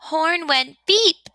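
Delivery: usual speaking voice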